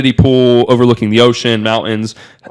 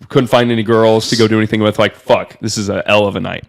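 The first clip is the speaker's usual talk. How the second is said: ordinary delivery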